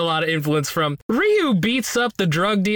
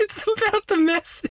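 high, giggling repeat of the line; laughing echo; 14 semitones higher than usual. Retold or imitated. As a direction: laugh through the quote.

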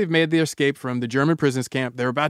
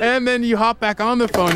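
plain reading voice

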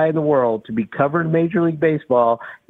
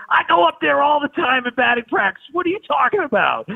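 much higher, mock exasperated yell; 11 semitones higher than usual. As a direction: imitate the player's brush-off, pitched way up. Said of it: "you yelled at me".

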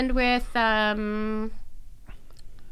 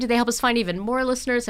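normal talking voice

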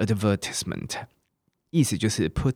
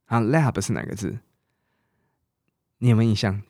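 ordinary talk

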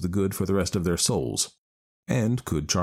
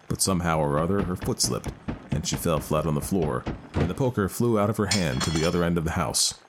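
usual speaking voice

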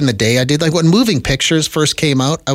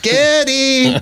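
put-on light Polish accent, high-pitched Geddy; 10 semitones higher than usual. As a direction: mimic a mom's Polish accent calling Geddy. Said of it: "his mom had a very thick Polish accent".

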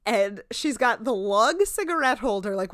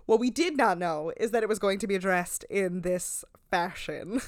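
ordinary talk, nothing marked

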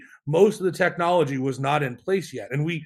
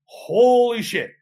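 slow, drawn-out, higher astonishment; stunned reaction; 7 semitones higher, 5 dB louder, much slower than usual. Retold or imitated. Founder recalling a big customer's unexpected call, stunned. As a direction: stretch it out in amazement.

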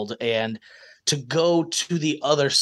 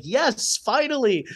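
excited cheer; 9 semitones higher, slower than usual. Retold or imitated. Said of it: "people that would be like".

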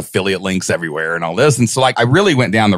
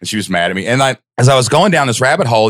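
ordinary talk, nothing marked

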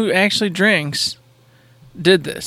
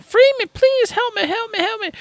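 begging damsel. Retold or imitated. Woman on the ground begs him to cut her loose, high falsetto plea. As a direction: beg to be freed in a squeaky woman voice. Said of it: "she's like".

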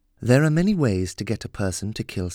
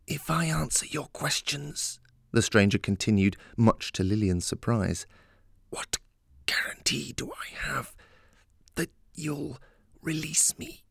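whispering; 4 semitones higher, 3 dB quieter than usual. A narrator's reading. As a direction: breathe it through clenched teeth. Captive woman answers, unvoiced far.

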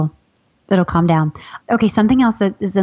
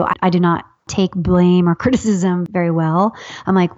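ordinary talk, nothing marked

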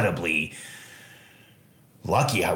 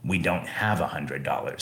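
soft plea; 6 semitones lower, 5 dB quieter than usual. Retold or imitated. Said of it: "she looked at me and just said".

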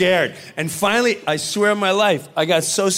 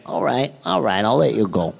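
lower, easing-off mobster voice; Joe Pesci impression; 8 semitones lower than usual. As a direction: let him off low and relaxed like Pesci. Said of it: "That was an incredible impression".

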